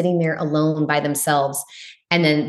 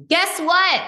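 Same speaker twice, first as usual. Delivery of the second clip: a cheeky truth-bomb, pitched way up; much higher, deliberate delivery of the punchline; emphatic outburst; 12 semitones higher, slower than usual. Real, not acted. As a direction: punch it out high and bold. Blunt provocative point to host.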